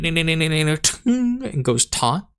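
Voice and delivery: ordinary talk, nothing marked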